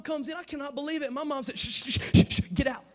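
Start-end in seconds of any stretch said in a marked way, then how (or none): 1.6-2.8 shooing away. Retold or imitated. Mom shooing girl out.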